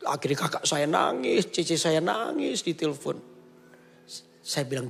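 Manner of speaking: ordinary talk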